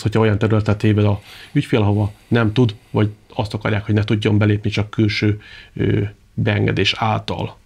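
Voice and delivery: normal talking voice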